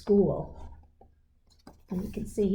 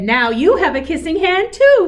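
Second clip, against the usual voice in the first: higher and louder, proud little voice; bright excited child; 8 semitones higher, 8 dB louder than usual. Acted. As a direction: say it like a delighted child. Child proudly voiced, high and loud.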